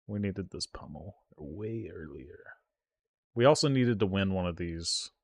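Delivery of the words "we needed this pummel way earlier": whisper. Real, not acted. Streamer whispering regret to chat over the late pummel. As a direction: breathe it out under your breath.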